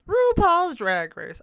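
high, loud, slow title call; big slow show title; announcer title. Shown as a demonstration. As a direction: announce RuPaul's Drag Race like a host. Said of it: "why did I say up?".

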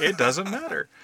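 hollered for comic emphasis; emphatic echo. Pretend. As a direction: call it back, stretched out and high. Echoing the shouted line.

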